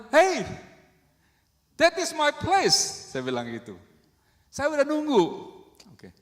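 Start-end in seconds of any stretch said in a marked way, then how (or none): 0.1-3.1 high, loud challenge in English; raised voice. Retold or imitated. Driver to car taking his parking space, high raised voice. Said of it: "Dengan suara yang tinggi". 4.5-5.2 high, loud retold protest; raised voice. Driver who has been waiting for the space, high raised voice. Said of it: "Dengan suara yang tinggi".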